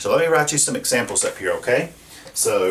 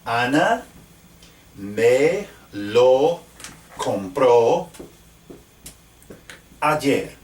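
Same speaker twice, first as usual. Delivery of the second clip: slow dictation word by word; much slower than usual. Shown as a demonstration. Dictating while writing on board.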